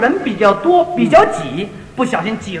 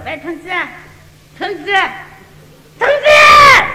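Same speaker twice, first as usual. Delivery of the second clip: Shanghai calling; 7 semitones higher, 3 dB louder than usual. Retold or imitated. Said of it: "找那售货员".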